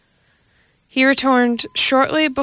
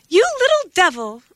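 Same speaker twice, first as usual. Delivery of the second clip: high, sharp scolding cat voice; scolding mother cat; 10 semitones higher than usual. A narrator's reading. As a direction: cuff the kitten with your voice. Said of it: "she meowed".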